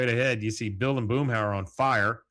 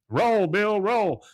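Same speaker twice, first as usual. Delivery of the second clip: loud, raised-pitch shouted order; urgent mock shout; 11 semitones higher, 6 dB louder, much slower than usual. Hank tells burning Bill to roll, shouting. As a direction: call it out urgently across the yard. Calling to man on fire.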